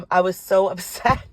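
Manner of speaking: ordinary talk, nothing marked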